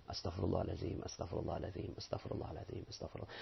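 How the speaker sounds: fading from soft to a whisper, humbly; sincere whispered istighfar. Shown as a demonstration. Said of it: "Maka pengucapan istighfar menjadi benar".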